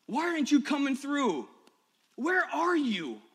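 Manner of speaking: loud, high, anguished shouting at God; a reenacted outburst of raw anger at God. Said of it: "just start shouting to God".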